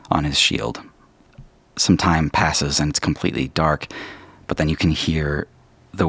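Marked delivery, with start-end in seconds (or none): none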